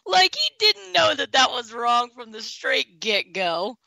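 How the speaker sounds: slow, laughing scorn; laughing disbelief. Real, not acted. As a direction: laugh at the excuse. Mocking the candidate, laughing.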